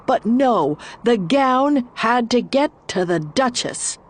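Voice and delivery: ordinary delivery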